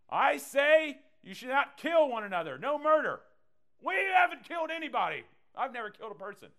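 high, loud, indignant mock voice; self-righteous protester. Pastor voicing a listener protesting to Jesus, high and indignant. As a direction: whine that you've never killed anyone.

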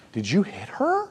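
high, puzzled mock question; mock clueless parent. Dad mocking himself as a confused father. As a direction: play a dad who has no idea. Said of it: "I'm not like".

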